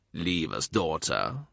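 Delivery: put-on low, soft, stern old father's voice; stern old man. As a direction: send her off with quiet authority. More dismisses his daughter.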